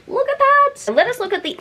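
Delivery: a high, delighted exclamation; excited. Real, not acted. Showing viewers the end page art, thrilled. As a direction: show it off, thrilled.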